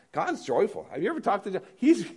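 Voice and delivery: ordinary delivery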